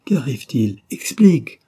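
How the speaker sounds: desperate wife begging for an answer; pleading. Acted. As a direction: beg him to explain.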